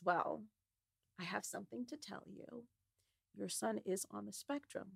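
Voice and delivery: quiet, careful delivery of bad news; soft and hesitant. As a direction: break it to her gently. Babysitter breaks hard news gently.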